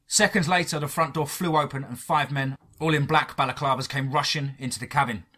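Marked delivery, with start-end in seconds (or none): none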